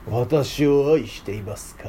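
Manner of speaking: slow, solemn period-drama delivery; dramatic voice. Shown as a demonstration. Teacher mocks a stiff Jesus for the children, slow and theatrical. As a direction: intone it like a samurai drama. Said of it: "ドラマチックな声で言ったの".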